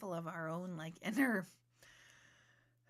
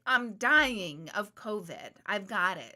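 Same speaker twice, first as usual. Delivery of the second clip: mock dramatic; 9 dB louder, slower than usual. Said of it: "I'm gonna be like".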